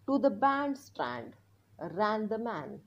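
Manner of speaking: very slow word-by-word reading; reading aloud slowly. Shown as a demonstration. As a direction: read it out slowly for learners to follow. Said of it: "आता आपण हे मोठ्याने वाचणार आहोत". (हळू मोठ्याने वाचन)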